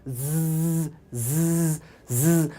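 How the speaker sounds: ज़ as a long voiced buzz with air pushed through the teeth; buzzing z sound. Shown as a demonstration. Teacher drills students on the ज़ sound, buzzing. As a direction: hold ज़ with teeth together and let it hiss-buzz. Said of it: "दांतों के बीच में से आपको हवा को निकालना है".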